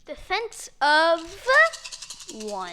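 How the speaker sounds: loud, slow, booming announcement; loud slow mock announcer call; dramatic announcement. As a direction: boom it out like an announcer.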